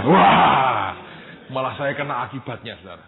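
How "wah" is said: high, loud "waah" of dismay; loud dismayed wail. Retold or imitated. Assistant suddenly told to preach instead, loud dismayed wail. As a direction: wail out as if doomed.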